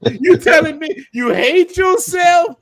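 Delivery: slow, loud disbelieving shout; incredulous shout. Real, not acted. Host mocking hateful women emailers, slow incredulous shout. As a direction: call out the hypocrisy, drawn out and loud.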